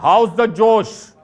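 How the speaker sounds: slow, loud rallying army cry; drawn-out loud Army cheer; army josh call. Retold or imitated. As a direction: call it out like an officer rousing troops. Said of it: "फौज में बोलते हैं ना".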